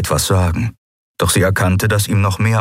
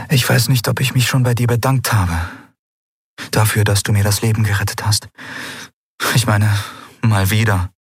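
higher-pitched woman, hesitant thanks; lightly pitched-up female character; grateful woman; 4 semitones higher than usual. Acted. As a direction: voice her in a higher register, thanking him.

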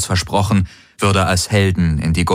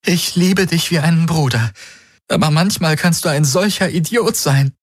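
tearful; 10 semitones higher than usual. Acted. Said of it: "während ihr die Tränen liefen".

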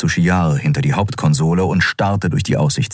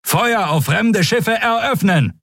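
slow, high-pitched firing order; drawn-out military cry, pitched way up; calling out; slower than usual. A narrator's reading. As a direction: call out the battle command, word by word. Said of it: "rief er".

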